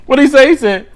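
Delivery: loud, high, hyped-up crowd voice; hyped roast. Retold or imitated. Retelling a Wildin' Out roast joke to the audience, loud and high. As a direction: belt the punchline like a hype man.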